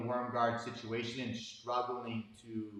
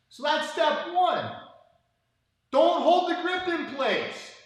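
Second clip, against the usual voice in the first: much higher, louder, slow and emphatic; 13 semitones higher, 8 dB louder, slower than usual. Real, not acted. Coach stressing rule one to viewers, loud and slow. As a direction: hammer the rule home. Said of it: "lesson punchline".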